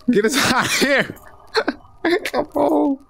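giggling, pleading to escape; laughing. Real, not acted. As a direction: laugh through the plea. Laughing while trying to escape.

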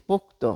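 "পোক্ত" as pok-to, ending in o; two syllables in "পোক্ত", pok-to; vowel added at end. Shown as a demonstration. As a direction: sound an o after the t in "পোক্ত". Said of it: "ত এর পরে কিন্তু অ ধ্বনিটি চলে এসেছে".

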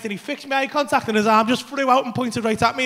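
ordinary talk, nothing marked

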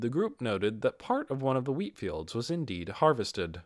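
ordinary delivery, nothing marked

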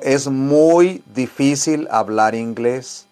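slowly, leaning on muy; slow emphatic repeat. Shown as a demonstration. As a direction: stress every word of "es muy difícil hablar inglés", slowest on muy. (despacio y con énfasis en "muy")

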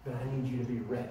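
low, soft, unhurried words of God; hushed voice of God. Acted. Preacher voicing God softly to his church.

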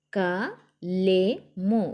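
"కలెము" very slow, split as ka, le, mu; letter by letter. Shown as a demonstration. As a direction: sound out "కలెము" with long gaps between letters. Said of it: "ఒత్తి పట్టి చదవాలి".

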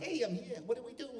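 high, soft chirpy cute-guy voice; lightly mocked sunny actor; Judd Hirsch thing. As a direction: play it sweet and harmless. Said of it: "doing his Judd Hirsch thing".

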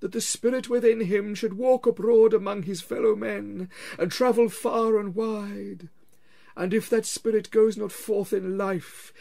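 high, slow, loud moaning spectre; wailing ghost. Acted. Marley's ghost wails at Scrooge, slow and loud. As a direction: drag each word out like a wail from the grave.

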